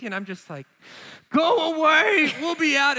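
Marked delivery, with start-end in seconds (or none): 1.3-3.0 loud, high, slow get-lost call; shooing dad. Retold or imitated. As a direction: shout the kid away, half joking. Dad shooing kid away.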